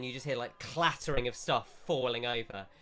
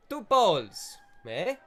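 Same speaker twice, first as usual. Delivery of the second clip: louder, higher, fast mock Italian shopkeeper; shopkeeper accent; 6 semitones higher, 8 dB louder than usual. Shopkeeper brushes off customer, louder fast mock Italian accent. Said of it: "He goes".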